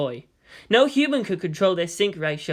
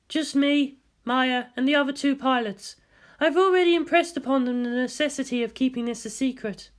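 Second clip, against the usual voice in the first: higher, lightly voiced female scientist; woman doctor voice; 8 semitones higher than usual. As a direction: lift the voice for the doctor's report.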